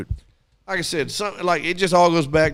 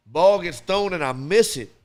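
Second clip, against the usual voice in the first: normal talking voice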